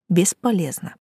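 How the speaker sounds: usual speaking voice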